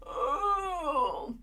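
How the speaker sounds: long, high yawny groan; stretching groan. Acted. Dingo stretches up after waking, groaning. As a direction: groan through a big stretch. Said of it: "does a big stretch up".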